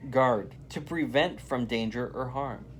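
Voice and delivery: ordinary delivery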